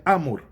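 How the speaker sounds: amor wrongly stressed as A-mor; flipped stress, AH-mor; stress moved to first syllable. Shown as a demonstration. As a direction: punch the A of amor, drop the mor. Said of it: "Se eu invertesse ela".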